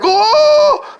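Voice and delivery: loud, very high drawn-out wail; long hammed-up howl of pain; mock scream of a man being stung; imitated yell. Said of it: "And he's yelling".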